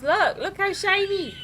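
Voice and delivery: high-pitched gleeful exclamation; excited delight. Real, not acted. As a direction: squeal it, thrilled with the shine. Showing off the clean result.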